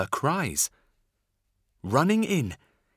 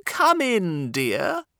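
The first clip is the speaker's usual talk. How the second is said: put-on high, loud old granny voice; grandma; 8 semitones higher, 4 dB louder, slower than usual. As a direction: call out sweetly as grandma. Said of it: "said Grandma".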